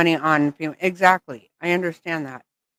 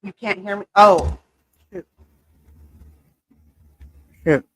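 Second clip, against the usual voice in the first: normal talking voice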